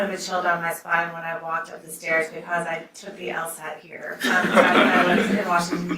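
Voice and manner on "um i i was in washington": words broken by a real chuckle; self-conscious laughing aside. Real, not acted. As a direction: laugh through the memory. Nervous joke about the bar exam.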